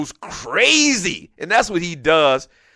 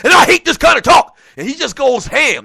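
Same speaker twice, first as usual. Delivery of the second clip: loud, higher ranting coach; lightly done fired-up coach rant; Herm Edwards impersonation; 6 semitones higher, 3 dB louder than usual. Retold or imitated. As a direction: rant like an angry ex-coach about players. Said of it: "That's my best Herm Edwards impersonation".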